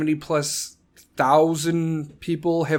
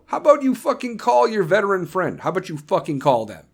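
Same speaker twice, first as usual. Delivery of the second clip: usual speaking voice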